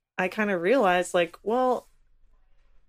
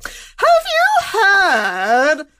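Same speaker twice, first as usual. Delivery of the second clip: put-on high, loud, slow affected posh English accent; silly posh accent; 11 semitones higher, 8 dB louder, slower than usual. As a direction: gossip like a Regency lady in a posh English accent. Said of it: "silly accent".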